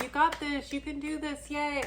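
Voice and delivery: rooting them on with a hint of fake pep; cheerleading. Cheering on a struggling friend, mock-excited.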